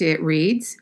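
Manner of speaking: usual speaking voice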